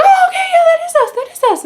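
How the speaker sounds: very high, loud mimicked excitement; exaggerated recognition. Actor recognising the photo, exaggerated excitement. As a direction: squeal it in a hammed-up high voice.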